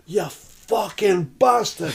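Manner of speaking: slow, high mock scream of abuse; furious missus. Missus barging into the pub at her man, furious. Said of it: "I assume as his missus walks in".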